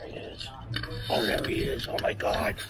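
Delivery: hushed, excited whisper; sneaky whisper while watching from nearby. Real, not acted. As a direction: breathe it so he won't hear. Sneaking up on a YouTuber.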